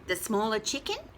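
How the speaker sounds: ordinary delivery, nothing marked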